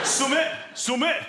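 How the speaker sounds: "Summit" with short Yorkshire u, like sum-it. Pretend. Comedian mimicking locals, Yorkshire "Summit". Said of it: "vague Yorkshire accent".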